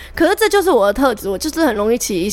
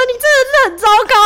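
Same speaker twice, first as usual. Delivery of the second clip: much higher, fast, hammed-up scolding; mock scolding boyfriend; 13 semitones higher, 4 dB louder, much faster than usual. Boyfriend berating his girlfriend, hammed-up high fast mock scolding. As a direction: parody his nagging in a much higher, rapid voice. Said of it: "他就说".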